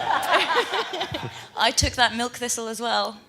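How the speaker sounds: laughing through it; giggling, embarrassed. Real, not acted. Teasing about last night's drinking.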